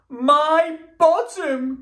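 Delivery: high, slow alarmed robot cry; a high drawn-out cry of dismay; cried out. Acted. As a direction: wail it out in shock. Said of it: "cried Bernard".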